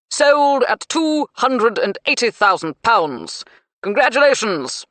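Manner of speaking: loud, slow auctioneer's call; projected, clipped announcement to the room; clipped, well-projected. A narrator's reading. Auctioneer closing a sale to the bidders, clipped and projected. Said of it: "In clipped, well-projected tones".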